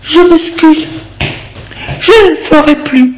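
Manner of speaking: high, frightened apology. Acted. Jeanne apologizing to her lover, frightened. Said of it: "d'un air apeuré".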